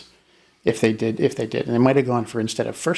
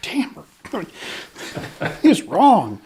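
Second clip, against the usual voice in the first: high juror voice, breaking into laughter; mock shock; 9 semitones higher, 3 dB louder than usual. Shocked juror chatting over lunch, hammed-up disbelief. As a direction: play a juror marvelling between bites, pitched up. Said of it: "I can picture them just, like, being so shocked".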